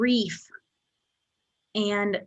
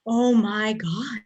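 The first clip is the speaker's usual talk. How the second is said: awestruck; 4 dB louder, slower than usual. Retold or imitated. It's hard to talk about without crying.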